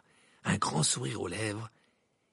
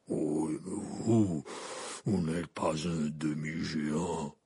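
low, slow half-giant voice; deep, sorrowful giant; Hagrid voice; 7 semitones lower, much slower than usual. A narrator's reading. Hagrid answers Dumbledore, low slow giant voice.

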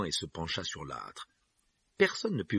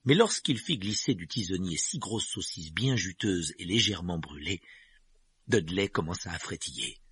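ordinary delivery

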